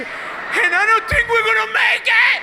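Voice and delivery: put-on high, drawn-out accented wail; dramatic panicked yell. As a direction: scream the doom like a hammed-up uncle. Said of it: "they're very dramatic and they love giving bad news".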